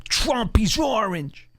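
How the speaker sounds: high-pitched mocking proclamation. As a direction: blurt it out high, ridiculing the obviousness. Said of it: "[name] came out and said".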